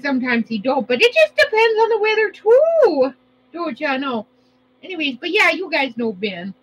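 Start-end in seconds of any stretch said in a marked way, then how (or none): 1.0-4.3 high, loud Minnesota lilt; playful Minnesotan, pitched high; Minnesota accent. Pretend. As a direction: lay on a singsong Minnesota accent. Slips into Minnesota catchphrase accent.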